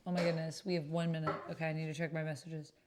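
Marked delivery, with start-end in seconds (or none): none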